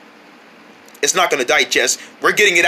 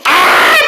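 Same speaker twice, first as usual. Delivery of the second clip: high, loud yell; launched scream; 12 semitones higher, 7 dB louder than usual. Acted. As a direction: scream as you fly off. Character launched into air.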